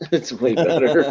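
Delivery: laughs through the words, slowed down; laughing. Real, not acted. Host laughing while defending the movie to friends. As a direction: chuckle it out while insisting.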